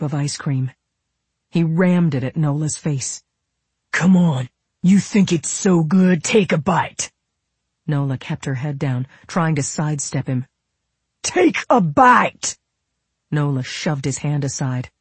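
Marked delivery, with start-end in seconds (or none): 3.9-7.1 raised, angry goading; heated, pushy taunt; bullying demand. A narrator's reading. Bully forcing spoon at daughter. 11.2-12.6 slow, high, screamed order; enraged shout, drawn out for force; furious command. A narrator's reading. Repeats the order, louder.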